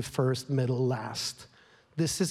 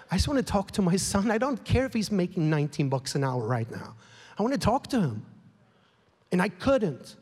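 ordinary talk

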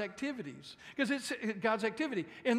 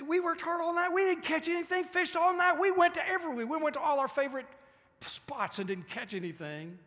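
a higher, louder whine of tired fishermen; complaining fisherman; 8 semitones higher, 5 dB louder than usual. Acted. Preacher voicing Peter, whining that they caught nothing. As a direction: complain about the empty night.

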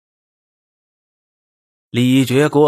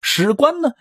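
ordinary delivery, nothing marked

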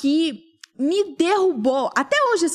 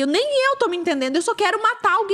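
fast, high retold rant; exasperated outburst; 8 semitones higher, faster than usual. Wife answering husband she cannot understand herself, exasperated. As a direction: burst out quickly, pitch climbing.

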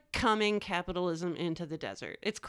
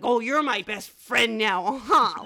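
slow, loud creepy sweet talk; 8 dB louder, slower than usual. Retold or imitated. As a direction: coo it at the dogs, unsettling. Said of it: "You had a voice".

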